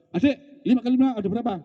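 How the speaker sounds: high, fast impatient quizzing; bapak memaksa. Acted. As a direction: press the child for an answer, quick and high. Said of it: "bapaknya maksa iku". (bapak mendesak, cepat dan bernada tinggi)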